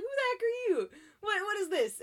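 high-pitched mock alarm; startled outburst. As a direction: play an alarmed grandma confronting a stranger. Grandma startled by a stranger, retold.

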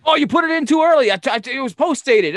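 whiny excuse voice. Retold or imitated. Imitating Billy explaining away the bounced check, high and whiny. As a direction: play the boss squirming out of blame.

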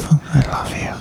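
soft whispered confession. Retold or imitated. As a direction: breathe it out as a whisper. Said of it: "and we were like".

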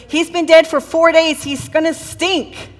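high-pitched objection, dragging the end; mock dismay, stretching out the last words; protesting. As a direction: imitate an appalled objection. Acting out Martha's protest.

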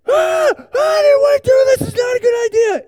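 high, loud panicked scream; hammed-up terror on the tightrope; mock screaming. Pastor to kids, acting out his tightrope ride, screaming in mock panic. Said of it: "I am screaming at first".

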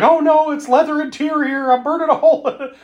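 high, fast panicky voice; panicked. Retold or imitated. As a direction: freak out about the burning seat, high and quick. She starts freaking out.

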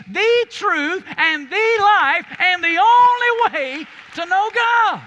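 loud, high, driving sermon delivery; fiery pulpit preaching to the congregation; full-force preaching. Acted. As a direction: preach it with everything, building to a roar.

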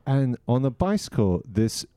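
ordinary delivery

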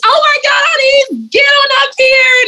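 very high squealed mock shout; gushing fan imitation. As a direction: call her up like a thrilled fan. Imitating crowds now calling her up.

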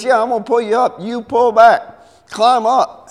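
high, loud commands; urgent shouting. Acted. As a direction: call orders down the rope. Acted shouting down the rope.

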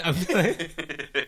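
slow, giggling boast; laughing gloat. Real, not acted. Winner bragging to friends, slow and laughing. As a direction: savor the victory, laughing.

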